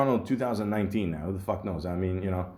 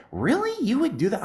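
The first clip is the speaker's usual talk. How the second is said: mildly mocking woman, pitched up; imitating her disbelief; 12 semitones higher than usual. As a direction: play the lady, amazed and pushing. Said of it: "She's like".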